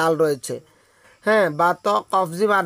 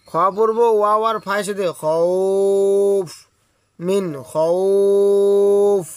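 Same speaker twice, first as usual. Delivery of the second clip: slow, with খৌফ held very long; long drawn madd; much slower than usual. Shown as a demonstration. Teacher spelling and drawing out খৌফ for the class. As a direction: stretch খৌফ out at the end. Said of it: "দুই আলিফ টানতে হবে".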